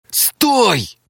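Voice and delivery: sharp high bark of a command; barking shout. A narrator's reading. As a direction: bark it out to stop her. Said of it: "гаркнул Мария". (гаркнуть громко и резко)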